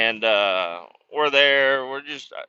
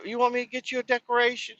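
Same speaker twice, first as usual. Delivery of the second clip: much higher, quick sing-song grandma voice; doting grandma; 13 semitones higher, faster than usual. Retold or imitated. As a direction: gush like a grandma spoiling a grandkid. Grandma on phone offering ornament.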